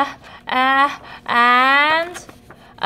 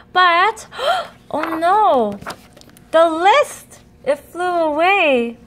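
dismayed gasp; 4 semitones higher than usual. Acted. Vincent loses his shopping list.

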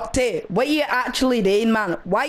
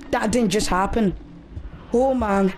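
ordinary talk

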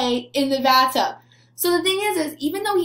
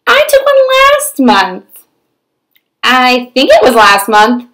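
stubborn pigeon voice; 12 dB louder, slower than usual. Acted. Teacher reading to children voices a stubborn pigeon. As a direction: play the stubborn pigeon, a touch whiny. Reads the pigeon's lines in character.

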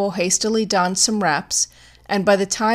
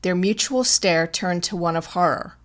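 ordinary delivery

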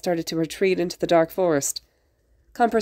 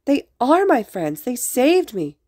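put-on high-pitched childish protest, words stretched; insistent denial; 8 semitones higher, 3 dB louder, slower than usual. A narrator's reading. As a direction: push back hotly, pitched up. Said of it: "Izuku denied".

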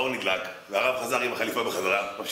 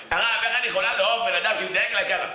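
much higher indignant protest; indignant wife; 8 semitones higher than usual. Retold or imitated. Wife protesting to the rabbi, higher and indignant. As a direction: plead your hurt.

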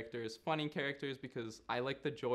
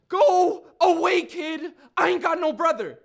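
angry dismissal; 18 dB louder than usual. Acted. Nigel rejecting the kid as brother, angry shout. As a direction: shout him away, high and loud.

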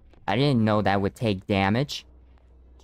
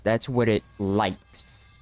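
usual speaking voice

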